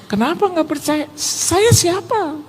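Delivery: high-pitched voice, stretched out at the end; mock incredulous question. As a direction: squeak out an exaggerated humble protest. Menirukan jawaban dalam percakapan. (bertanya heran dengan suara tinggi dibuat-buat)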